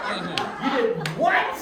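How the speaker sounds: high, loud laughing gasp of disbelief. Real, not acted. Bob hearing her insult, laughing in disbelief. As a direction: laugh it out in shock.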